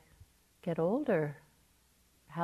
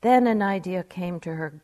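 normal talking voice

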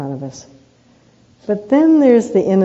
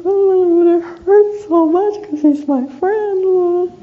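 much higher, slow, wounded whimper of a hurt self; hurt inner voice; 13 semitones higher, 7 dB louder, much slower than usual. Acted. Telling her husband how the hurt part of her cries out, high and wounded. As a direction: play the hurt part that wants comfort. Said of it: "there's this part of me".

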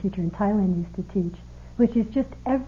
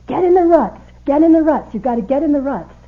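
shouted orders from the car ahead, much higher and louder; 6 semitones higher, 8 dB louder than usual. Retold or imitated. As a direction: yell the order over the engine. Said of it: "Grove kept going".

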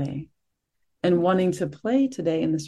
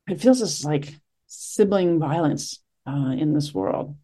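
usual speaking voice

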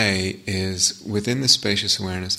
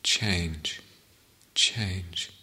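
guided meditation. Acted. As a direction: ease them into the sitting, unhurried.

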